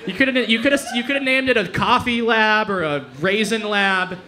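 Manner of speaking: normal talking voice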